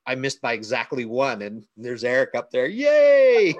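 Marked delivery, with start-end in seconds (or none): 2.7-3.5 high, loud, drawn-out yay; mock celebration cheer. Friend mimicking the raffle winner's celebration, mock cheer.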